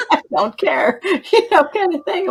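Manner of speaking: laughing high and loud through the words; giggling at her own story. Real, not acted. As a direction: laugh out loud while saying it. Laughing retelling the call.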